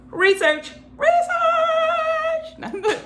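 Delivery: high, rising exclaim into a laugh; excited exclaim. Acted. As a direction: cry it out giddily, laughing. Playful exclaiming.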